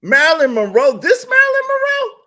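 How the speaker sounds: pressing again, sure he misheard; incredulous. Retold or imitated. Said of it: "I was like".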